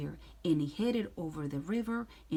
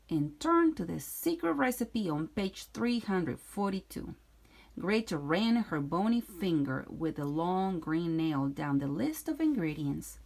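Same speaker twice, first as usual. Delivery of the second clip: plain reading voice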